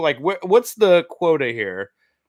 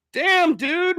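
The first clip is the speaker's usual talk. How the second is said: high, stunned "damn, dude"; shocked exclamation; 12 semitones higher than usual. Real, not acted. Reacting to the penis measurements, he yelps in shock. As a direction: let it jump up in shock.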